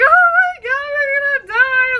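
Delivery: loud, very high mock wail; hammed-up sobbing. Storyteller acting out the second guy stranded on safari, mock sobbing. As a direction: wail in panic as if sobbing hard. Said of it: "starts, like, sobbing".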